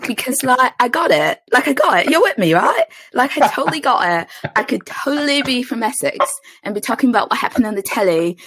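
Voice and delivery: put-on Essex accent, pitched high and lively. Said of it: "wanted to sound like I was a little bit from Essex".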